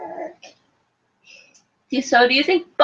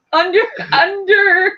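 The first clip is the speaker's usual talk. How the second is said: laughing; 7 semitones higher, slower than usual. Real, not acted. Laughing at her own guess.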